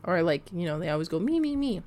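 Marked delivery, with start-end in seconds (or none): 1.2-1.8 mocking whine. Mocking self-centred coworkers.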